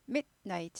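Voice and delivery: mid, a gap, then night in midnight; cleanly broken midnight. Shown as a demonstration. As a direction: cut midnight sharply after mid. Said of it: "잘 끊으셔야지 고급 발음 합니다".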